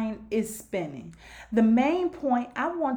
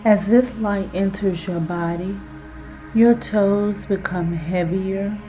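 guided hypnosis; 4 semitones lower, 4 dB louder than usual. Acted. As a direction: lull the listener down into relaxation. Guided self-hypnosis exercise.

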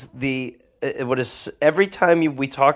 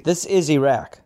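normal talking voice

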